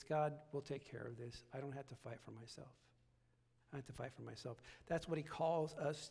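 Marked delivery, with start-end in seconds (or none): 0.0-4.6 humble quiet prayer. Retold or imitated.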